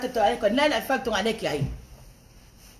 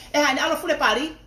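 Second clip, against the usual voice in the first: high, loud retold snap in Tongan; retold outburst; 6 semitones higher, 4 dB louder than usual. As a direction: fire back at the brother. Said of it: "I went like this".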